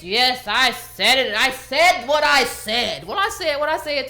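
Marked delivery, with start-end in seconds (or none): 0.0-3.1 slow, louder, every word leaned on; mock defiant doubling down. Mimicking the woman who doubled down, mock defiant and slow. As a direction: imitate someone digging in, slow and emphatic. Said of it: "She was like".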